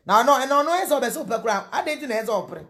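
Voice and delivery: high, loud, exasperated questions; exasperated outburst. Real, not acted. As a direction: push it up, baffled and worked up. Exasperated outburst about the husband.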